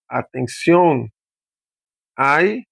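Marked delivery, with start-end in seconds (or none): 0.1-1.1 slow "Atención", leaning on the last syllable CIÓN; stressed final syllable. Shown as a demonstration. Teacher showing where the tonic syllable falls, slow "Atención" stressed on CIÓN. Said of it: "pero la sílaba tónica aquí".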